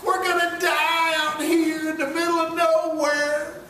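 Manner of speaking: whining complaint. Retold or imitated. Israelites in the wilderness crying out at Moses, whining. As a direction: wail at Moses to take them back.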